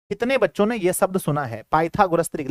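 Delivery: usual speaking voice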